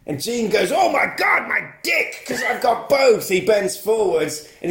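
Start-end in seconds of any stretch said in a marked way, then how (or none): 0.7-3.3 high, hammed-up cry of agony; mock pained yell. Villain kicked by the hero, high mock-agonised yell. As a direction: wail it high as if just kicked.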